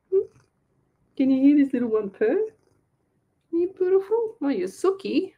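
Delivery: high-pitched doting pet talk; cooing to a pet. Real, not acted. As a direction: dote on a purring cat. Doting on her purring cat.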